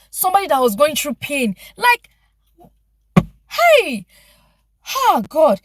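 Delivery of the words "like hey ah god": high, loud cry of disbelief; shocked exclamation. Real, not acted. Vlogger reacting to a receptionist's mockery, high and loud. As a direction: burst out in outrage. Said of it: "exclaiming in disbelief".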